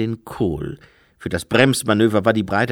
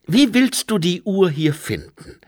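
put-on young Lobsang; 8 semitones higher, 5 dB louder than usual. Lobsang asks, higher voice.

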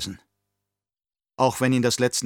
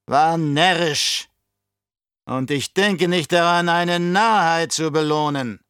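put-on higher, louder, oily old Baron voice; scheming old Baron; 7 semitones higher, 6 dB louder than usual. Baron to his nephew, raised and sly. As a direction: play the cunning uncle sounding out his nephew.

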